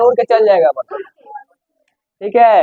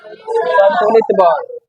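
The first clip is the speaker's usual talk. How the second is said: slow drawn-out answer; much slower than usual. Shown as a demonstration. Teacher answering her own question, slow and drawn out. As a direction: stretch each syllable of संतुलित बल out slowly. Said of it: "तो लगाया गया बल क्या कहलाता है?".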